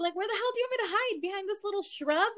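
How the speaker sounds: high-pitched disbelief; retold incredulous protest. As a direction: protest an absurd suggestion, voice shooting up. Incredulous reply to being told hide.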